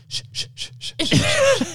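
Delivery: repeated breathy shushes; shushing. Retold or imitated. Dad hushing his pillow in the dark, frantic shushing. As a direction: shush a baby that is really a pillow.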